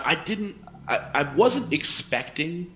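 ordinary talk, nothing marked

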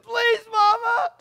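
put-on high, loud, slow pleading child; mock plea of a little boy; begging child. Preacher imitating the boy begging his mother, pleading. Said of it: "the little boy said".

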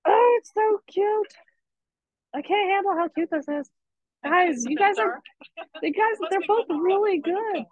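high, delighted gushing over cute art. Real, not acted. Teacher gushing over kids' paintings.

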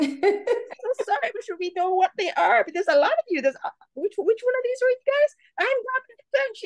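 hammed-up clueless American apologizing; mock apology.

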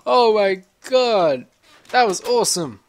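loud, high, drawn-out victory yell; real elated shouting. Real, not acted. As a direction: burst out in triumphant disbelief. Makes hard jump first try.

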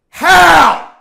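high, loud, indignant "How?"; outraged shout. Real, not acted. Outraged anime rant.

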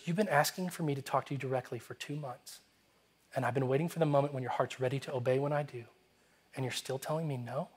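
soft, low, tender reproach; very gentle. Retold or imitated. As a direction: imitate God speaking gently, voice dropped. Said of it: "he's speaking very gently".

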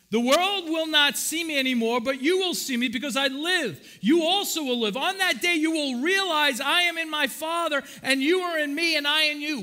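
loud, high preaching of scripture. Acted. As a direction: proclaim the verse with force. Rereading the scripture with force.

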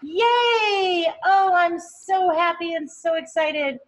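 loud, high, gushing praise with drawn-out oh; delighted cheering. Real, not acted. Teacher gushing over students' paintings, loud delighted cheering. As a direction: cheer them on like a proud teacher.

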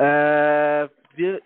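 normal talking voice

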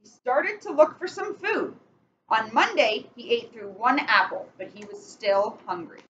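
slow storybook reading with steady rhythm; picture-book read-aloud, measured and clear; reading to children. Acted. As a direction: read it like bedtime story time for kids. Reads picture book to kids.

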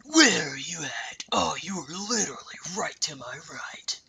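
hushed unvoiced whisper. Real, not acted. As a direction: whisper so nobody hears. Whispering to hide.

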